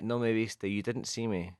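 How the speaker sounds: usual speaking voice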